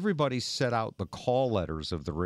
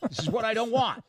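talking to the algorithm; 11 semitones higher than usual. Retold or imitated. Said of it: "I was like".

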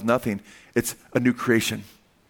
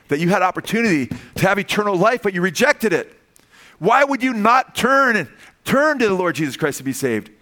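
higher, pleading pulpit cry; impassioned preaching; 7 semitones higher, 3 dB louder than usual. Real, not acted. Preacher pleads with the unsaved to turn, impassioned preaching. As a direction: press the appeal, pitch climbing.

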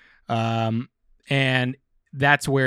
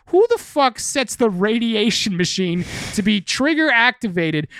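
incredulous yell; 9 semitones higher, 4 dB louder than usual. Retold or imitated. Fan ranting at the comic's silly machine, incredulous yell. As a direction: pitch jumps high and louder, disbelief. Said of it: "I'm like".

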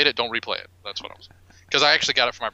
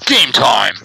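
announcer shout; 2 semitones lower, 10 dB louder than usual. Acted. Announcing the game segment.

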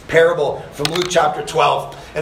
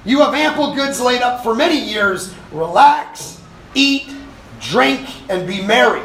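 gloating rich man; 7 semitones higher than usual. Acted. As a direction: crow over your full barns. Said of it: "rich man in the parable".

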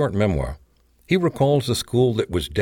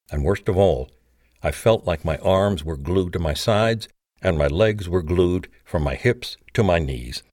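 plain reading voice

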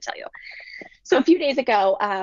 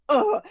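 high, fed-up ugh; a boy's annoyed ugh; exasperated groan; 13 semitones higher, 4 dB louder than usual. Retold or imitated. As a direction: let out a kid's frustrated groan. Son reacts to sister's jab.

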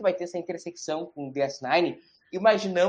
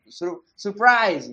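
stretched, teasing surprise; dramatic slow reveal; much slower than usual. Pretend. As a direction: drag the word out for suspense.

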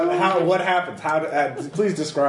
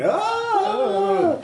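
fearful yelp. Acted. GM voices gibbering fear.

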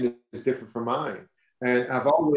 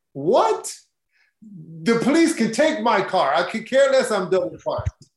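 thrilled, nothing else matters; excited high-pitched retelling; giddy excitement; 6 semitones higher than usual. Retold or imitated. Retells dropping everything for [name].

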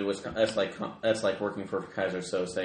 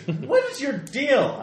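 annoyed; 6 dB louder, much slower than usual. Acted. Annoyed at driver touching radio.

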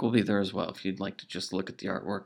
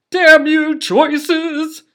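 much higher, loud fist-shaking cry; sputtering foiled villain; 14 dB louder, much slower than usual. Acted. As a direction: curse the sky like a cartoon villain. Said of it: "As he shakes his fist towards the sky".

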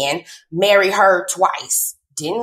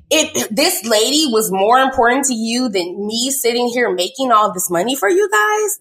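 normal talking voice

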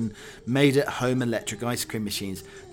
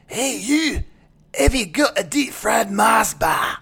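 high, loud mock Scottish voice; hammed-up Scottish doll calling out; Scottish accent; 12 semitones higher, 8 dB louder, slower than usual. Host imagining the doll's next line in a Scottish accent. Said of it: "in a strong Scottish accent".

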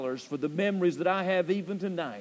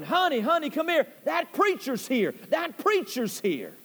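higher, lightly imitated holler to his wife; mock holler; 6 semitones higher than usual. Man calls his wife to greet the preacher, mild mock holler. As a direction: mildly play the man calling out, slowing at the end. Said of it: "he started hollering".

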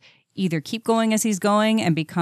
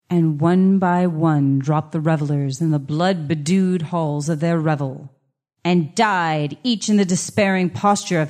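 gothic story narration; 3 dB louder than usual. Acted. Reads Poe narration.

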